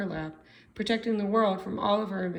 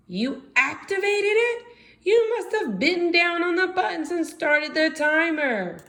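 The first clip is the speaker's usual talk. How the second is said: much higher, loud panicked cry; alarmed panic; 10 semitones higher, 4 dB louder than usual. Acted. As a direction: sound the alarm, horrified. Franny panics at ticking bomb.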